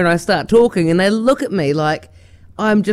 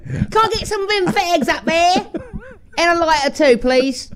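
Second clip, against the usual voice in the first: higher, hammed-up British accent; put-on English accent; 8 semitones higher than usual. Shown as a demonstration. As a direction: order like an English lady off Jeremy Kyle. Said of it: "talking like one of those ladies on Jeremy Kyle".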